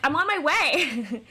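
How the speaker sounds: mock-insist, stretching the words out; insistent protest. Retold protest to impatient date.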